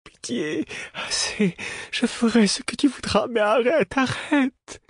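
pleading. A narrator's reading. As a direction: beg desperately for it to stop. Tortured fool begging in delirium.

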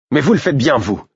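high, loud indignant protest. A narrator's reading. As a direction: throw the accusation back. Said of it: "protestai-je".

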